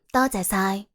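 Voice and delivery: ordinary delivery, nothing marked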